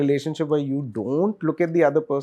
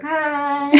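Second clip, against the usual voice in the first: mock high hi; 13 semitones higher, 4 dB louder than usual. Friends greeting from across river.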